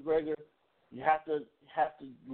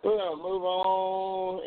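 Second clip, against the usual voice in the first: slow, stretched, higher sing-out; drawn out; 4 semitones higher, slower than usual. Real, not acted. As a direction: stretch it out as a segue. Drawn-out lead-in to a song.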